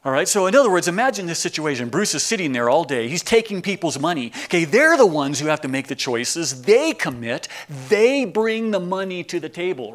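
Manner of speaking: fired-up sermon build; excited preaching. Acted. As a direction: build the point with energy.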